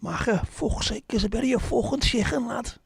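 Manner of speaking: over-the-top Scouse lad squawk. Acted. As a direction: ham up a Liverpool lad. Exaggerated Scouse lad outburst.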